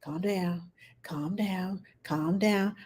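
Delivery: softly repeated calm down; self-soothing. Retold or imitated. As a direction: talk yourself down, hushed. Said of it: "I'm like".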